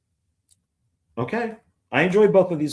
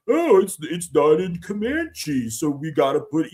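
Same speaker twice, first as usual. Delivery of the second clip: mocking complainer; 3 semitones higher than usual. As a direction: put on an exaggerated voice to ridicule a fussy critic. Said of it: "a fucking asshole and be like".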